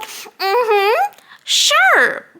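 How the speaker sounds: high, fake-agreeable singsong; mock-sweet brush-off; dismissive agreement. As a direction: humor them with a high, insincere yes. Acting out dismissive reply to boss.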